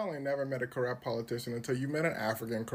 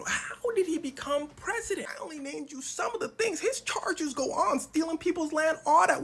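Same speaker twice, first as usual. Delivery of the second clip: mock incredulous; 12 semitones higher, 3 dB louder than usual. Comedian mimics audience question.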